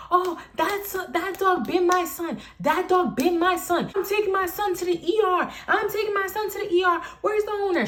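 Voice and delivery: high-pitched mocking harping; relentless complaining mother. Storyteller mimics the mother threatening the ER, high insistent voice. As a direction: mimic her going on and on, up high. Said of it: "She kept on, kept on, kept on".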